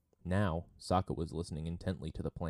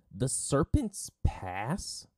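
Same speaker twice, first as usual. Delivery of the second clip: high, loud, drawn-out disbelieving question; stretched, incredulous cry of "Serpent's Pass"; shocked outburst; 8 semitones higher, 5 dB louder, much slower than usual. A narrator's reading. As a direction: erupt on "Serpent's Pass", voice rising. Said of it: "Sokka erupted".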